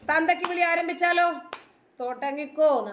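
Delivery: high, held-up mock invitation; taunting challenge. Real, not acted. Vlogger inviting abuse from critics, taunting. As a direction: throw it out as a high-pitched dare. Taunts viewers to start abusing.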